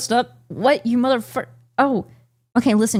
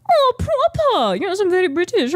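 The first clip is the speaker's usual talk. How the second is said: put-on British accent. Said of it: "How do you be British?".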